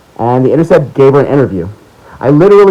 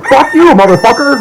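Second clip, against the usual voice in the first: mock angry outburst; 11 semitones higher than usual. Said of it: "Joe Biden's gonna get angry and say".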